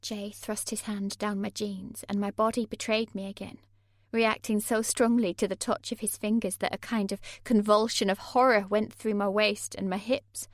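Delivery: ordinary delivery, nothing marked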